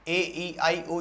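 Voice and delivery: normal talking voice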